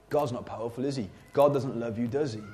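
low, hushed taunting voice in the head; sly, quiet inner accuser; insinuating inner voice. Acted. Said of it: "we all hear voices".

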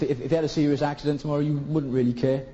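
ordinary talk, nothing marked

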